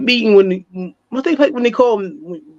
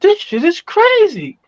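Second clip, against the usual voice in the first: high, drawn-out exclamation; retold amazement; 5 semitones higher, slower than usual. Newcomer seeing Baltimore's decrepit streets, retold amazement. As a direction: relive the amazement, slow and high.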